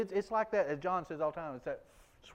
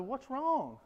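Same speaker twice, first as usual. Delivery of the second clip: puzzled question; 10 semitones higher than usual. Retold or imitated. Retold question to his interpreter.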